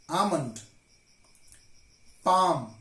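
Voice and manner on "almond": ALMOND as "ah-mund", with no L; silent L. Shown as a demonstration. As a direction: correct ALMOND by dropping the L. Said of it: "L is silent in these words".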